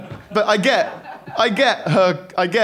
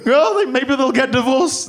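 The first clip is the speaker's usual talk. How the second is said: put-on higher, slower anxious child's fretting; worried inner voice; 7 semitones higher than usual. Kid on the landing fretting about his parents, high and anxious. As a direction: fret like a scared kid on the stairs.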